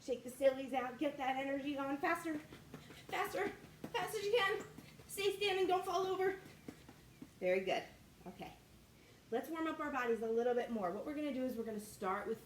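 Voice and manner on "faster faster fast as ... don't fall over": urging faster. Acted. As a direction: push them to go their fastest.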